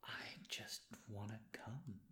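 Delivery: unvoiced breathy whisper. Real, not acted. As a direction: whisper it like a confession. Confession amid soft rambling.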